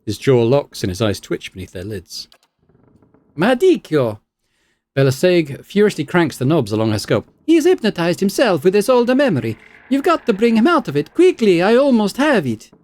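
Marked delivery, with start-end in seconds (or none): 3.4-4.2 calling out. Acted. Reads game dialogue, shouted name. 7.4-12.7 high, hurried researcher voice; high-pitched urgent scientist. Acted.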